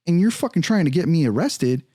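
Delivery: acting out heated outrage; indignant accusation. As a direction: throw the accusation back at the officer. Acted quarrel with the officer.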